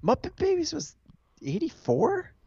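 high pitched disbelief; incredulous, voice jumping way up; surprised. Real, not acted. Disbelief at the date.

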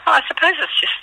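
strained voice that is failing; voice giving out. Real, not acted. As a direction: voice cracking up high and breaking. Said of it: "losing my voice".

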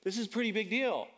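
high, awestruck preacher's pitch, words stretched out; impassioned preaching. Real, not acted. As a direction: preach it with amazed, mounting fervour. Preaches with rising fervour.

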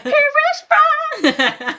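high, slow laughing impression of Boris Johnson; hammed-up high laughing Boris; mock Boris. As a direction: squeal it out, cracking up as a blustering Boris.

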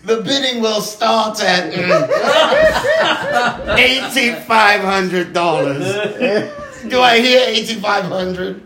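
mock British auction call, slow and laughing; posh British auctioneer. Friends joking about weed auctions, mock British auctioneer voice. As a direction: ham up a posh British auctioneer taking bids. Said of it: "Why has he gotta be British".

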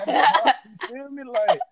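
slow, high, laughing through it. Real, not acted. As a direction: let the laugh carry it. Laughing through own story.

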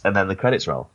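normal talking voice

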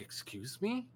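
high, quiet questioning lift; puzzled cashier. Retold or imitated. Cashier to a Canadian customer, puzzled.

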